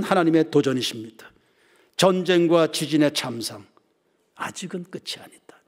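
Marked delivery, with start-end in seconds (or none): 4.3-5.6 hushed, urgent whisper. Acted. Preacher whispering a warning to the congregation. Whispered then repeated aloud.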